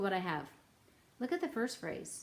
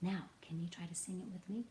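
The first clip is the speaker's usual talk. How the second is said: whispered; 4 semitones lower, 8 dB quieter, faster than usual. Real, not acted.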